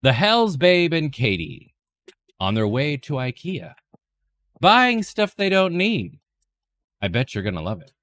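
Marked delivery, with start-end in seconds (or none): 0.0-6.2 mock trailer voice. Mock trailer about ikea trip.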